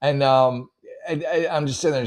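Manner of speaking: ordinary talk, nothing marked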